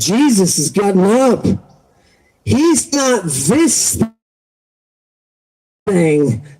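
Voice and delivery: slow it right down and land on "thing"; spooky storyteller's reveal, stretched and slow; dramatic quote. Retold or imitated. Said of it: "Kid says".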